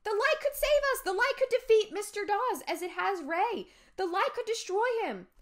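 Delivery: high, loud, desperate shouting; urgent pleading. A narrator's reading. Amanda to Josh with the flashlight, pleading loudly. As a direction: beg at the top of your voice. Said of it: "I pleaded".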